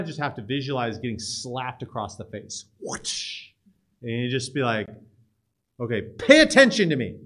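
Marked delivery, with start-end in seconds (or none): 2.8-3.5 voiceless whoosh for a slap; whooshing slap noise. Shown as a demonstration. Imitates slap across the face. 5.8-7.2 loud, raised-pitch demand for attention. Acted. Acts out demanding students' attention.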